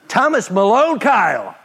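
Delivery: stern mom calling. Retold or imitated. Mom scolding her lying son by full name, stern. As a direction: call him out like an irritated mother.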